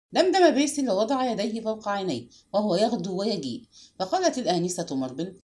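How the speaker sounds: usual speaking voice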